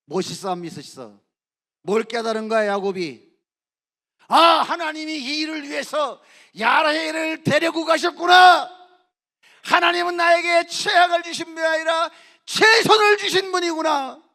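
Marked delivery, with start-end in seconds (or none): 4.3-14.3 bursting out as it finally dawns on him; triumphant preacher's shout of discovery; shouted realization. Acted. Jacob's sudden realization, shouted.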